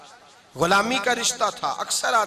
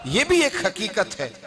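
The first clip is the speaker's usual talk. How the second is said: normal talking voice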